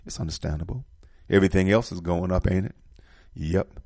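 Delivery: ordinary talk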